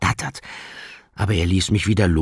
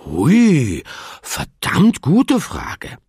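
high, slow, astonished voice; amazed; 12 semitones higher, 7 dB louder, much slower than usual. Acted. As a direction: marvel at it aloud. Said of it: "staunte Arka".

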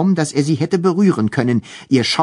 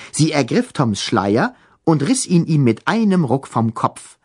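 ordinary delivery

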